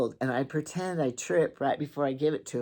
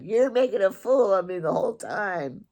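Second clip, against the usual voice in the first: mock mad; 6 semitones higher, 4 dB louder than usual. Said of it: "He got kinda mad".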